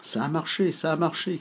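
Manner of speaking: high, gleeful cheer of success; excited cry. Acted. Lizou Bizou cheers to the others, dancing under the water jet, high and gleeful. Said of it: "cria Lizou Bizou à tue-tête".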